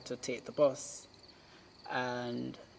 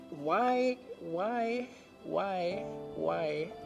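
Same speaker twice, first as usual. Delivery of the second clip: retold inner questioning; 5 semitones higher, much slower than usual. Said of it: "I was thinking in the moment".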